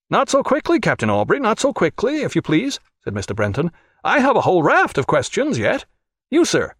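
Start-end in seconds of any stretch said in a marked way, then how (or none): 0.1-2.8 much higher, fussy protest; agitated official objecting. A narrator's reading. Brenton stops Jack leaving, high fussy protest. 4.0-6.7 much higher, fussy protest; agitated official objecting. A narrator's reading. Brenton stops Jack leaving, high fussy protest. Brenton insists on more questions.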